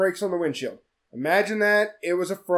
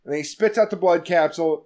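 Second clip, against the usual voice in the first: ordinary talk, nothing marked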